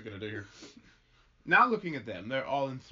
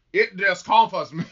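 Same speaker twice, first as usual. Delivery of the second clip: loud, higher stage outburst; acted agitation; 5 semitones higher, 12 dB louder, slower than usual. Farmer complains to minister about his wife, agitated. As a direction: push it out, troubled and emphatic.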